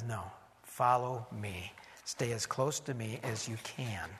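low, soft, measured voice of God; God's appeal. Acted. Preacher voices God answering the world, low appeal.

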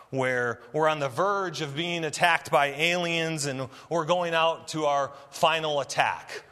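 ordinary talk, nothing marked

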